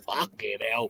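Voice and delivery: ordinary talk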